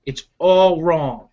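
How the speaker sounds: usual speaking voice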